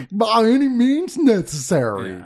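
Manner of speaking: high, loud, drawn-out Texan twang; Texas accent. Acted. Host mimics Houston giving orders, hammed-up slow, high Texas accent. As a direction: stretch it out in an over-the-top Houston accent. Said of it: "it's in Texas, so you have to talk like that".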